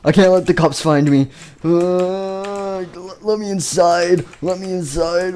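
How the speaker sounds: high, urgent pleading to be let in; hammed-up desperate begging; acted panic. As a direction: wail like a fugitive on the run. Acts fugitive fleeing from the cops.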